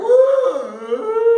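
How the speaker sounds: high, long pretend wail; drawn-out fake bawling "uh"; mock crying. Teacher miming the verb for students, mock wail.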